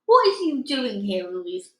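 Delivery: loud giant bellow, slowing on the insult. A narrator's reading. Giant confronting the BFG, bellowing. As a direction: boom it down at the BFG. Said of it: "he bellowed".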